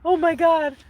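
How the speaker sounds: overawed Phantom. Retold or imitated. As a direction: gasp it out in hammed-up rapture. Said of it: "he's like".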